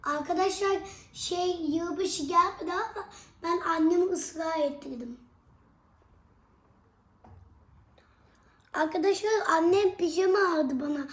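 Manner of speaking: bright, loud address to viewers; loud vlogger voice. Real, not acted. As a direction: announce it to the fans.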